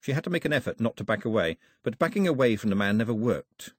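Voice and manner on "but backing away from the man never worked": usual speaking voice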